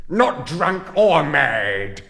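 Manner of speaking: loud, slow, high outcry; furious cry. Acted. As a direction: shout it in outrage. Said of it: "cried the pauper".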